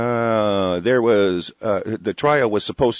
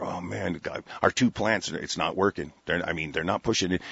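softer, worried mutter; mock fretting prosecutor; 4 semitones lower, 7 dB quieter than usual. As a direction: grumble it under your breath. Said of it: "the prosecutor's sitting there".

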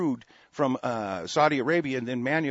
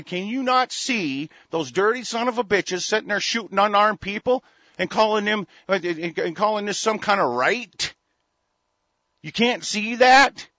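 raised, high-pitched indignant quote; exasperated outrage; 7 semitones higher, 5 dB louder than usual. Retold or imitated. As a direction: voice the world's disbelief, loud and high. Acts out outraged people worldwide.

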